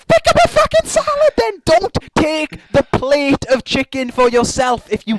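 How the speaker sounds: high-pitched exasperated rant; hammed-up indignation, slowing to hammer it home; exasperated outburst. Ranting at the chicken-holding woman who frets about weight, exasperated.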